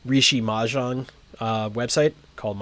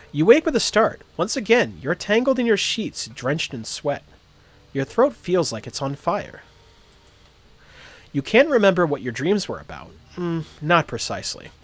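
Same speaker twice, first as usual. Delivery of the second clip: raised, dramatic storybook narration; story narration; 4 semitones higher than usual. Acted. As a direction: read it like an audiobook narrator. Reads game story text aloud.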